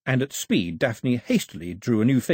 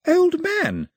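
high, loud delighted shout; girl shouting in delight, much higher; 5 dB louder than usual. A narrator's reading. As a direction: call it out as she finally gets it. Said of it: "shouted Daphne".

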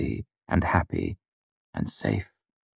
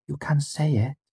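high, gentle encouragement; tender girl's voice, higher; gently; 10 semitones higher than usual. A narrator's reading. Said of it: "Auri said gently".

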